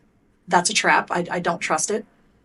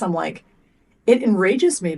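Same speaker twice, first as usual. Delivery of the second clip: normal talking voice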